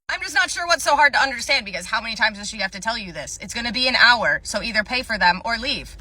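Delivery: ordinary talk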